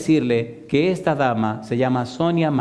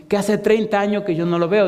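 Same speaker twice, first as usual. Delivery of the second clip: voiced old woman; 8 semitones higher than usual. Old mother correcting her pastor, high insistent plea. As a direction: plead like the grieving old mother.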